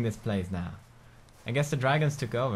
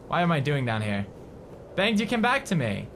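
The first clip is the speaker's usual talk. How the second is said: normal talking voice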